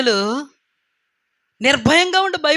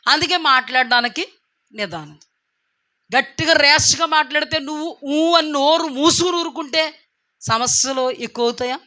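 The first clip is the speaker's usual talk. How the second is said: usual speaking voice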